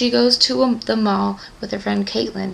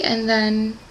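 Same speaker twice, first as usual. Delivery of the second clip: normal talking voice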